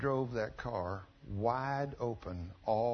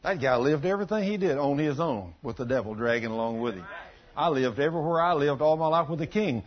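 loud, raised preaching voice; full-force preaching; 3 semitones higher, 8 dB louder than usual. Acted. Preacher driving the point home to the church, loud and raised. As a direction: preach it out hard to the back pews.

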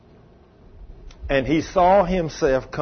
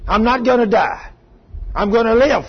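higher, louder refusal to die; defiant shout; 7 semitones higher, 5 dB louder than usual. Retold or imitated. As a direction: fight back against death. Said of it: "I retaliated".

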